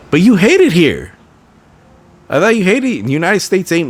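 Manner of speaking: loud, high mocking retort; sarcastic jab. Real, not acted. Host taunting the athlete, loud high sarcasm. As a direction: needle her with mock disbelief. Mocking her wanting back.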